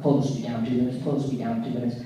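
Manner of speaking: lightly voiced agent, low pitch; a hint of a stern security announcement; serious Secret Service. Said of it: "very serious. interaction, 'cause there's Secret Service".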